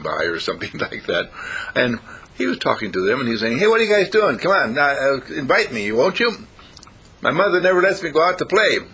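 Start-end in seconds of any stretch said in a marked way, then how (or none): 3.6-8.8 high, loud, eager dog voice; konuşan köpek. Acted. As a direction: plead like a dog wanting to join in. Voices neighbour's dog talking.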